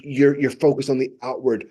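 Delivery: ordinary talk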